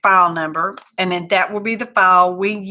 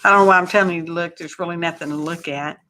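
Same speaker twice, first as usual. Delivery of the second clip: usual speaking voice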